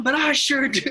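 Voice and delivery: high, loud, unhinged mock yell. Quoting Blair axing the radio room, a high loud mock yell. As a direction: bellow it like a man losing his marbles.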